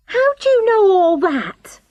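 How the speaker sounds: high, startled question; frightened woman crying out; alarmed cry. A narrator's reading. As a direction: cry out, caught off guard. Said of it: "cried Charlotte".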